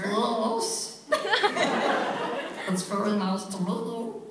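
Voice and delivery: high silly creature voice; chicken monster voice. Pretend. Voice actor playing chicken monster for the interviewer. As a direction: play the chick monster sweetly. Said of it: "I'll be that character".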